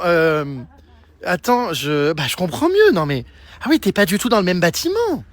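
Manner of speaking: normal talking voice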